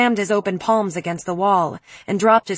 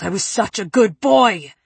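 anguished yell, a little louder; 3 dB louder than usual. A narrator's reading. Preppy yelling at the wall, anguished. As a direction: yell it as the fist hits the wall. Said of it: "he yelled".

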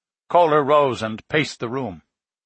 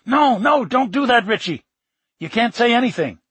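much higher, urgent no no; panicked; 10 semitones higher, 3 dB louder than usual. Acted. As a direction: stop him in a panic. Panicked stopping his friend.

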